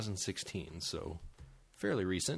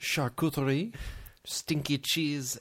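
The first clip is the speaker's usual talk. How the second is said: mock French; 5 semitones higher, 4 dB louder, slower than usual. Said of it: "I made it sound French".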